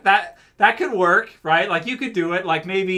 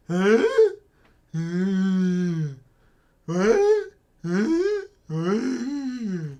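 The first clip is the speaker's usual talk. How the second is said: clueless zombie voice; 3 semitones higher, much slower than usual. Pretend. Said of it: "That's what I'm gonna do now".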